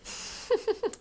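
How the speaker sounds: a soft squeaky giggle; high breathy giggle. Real, not acted. Laughing at the letter.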